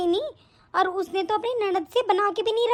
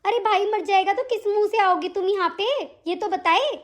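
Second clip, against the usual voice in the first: usual speaking voice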